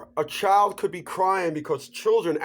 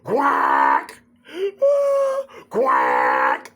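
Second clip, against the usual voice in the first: loud, low, honking mock sob; mock sobbing wail; 4 dB louder than usual. Imitating a woman sobbing over the run-over cat, loud honking wail. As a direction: wail like a heartbroken honking duck.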